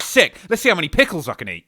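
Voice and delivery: loud, high burst of excitement; high-pitched hyped yell. Acted. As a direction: shout it like the pill just kicked in. Acted excitement after taking drug.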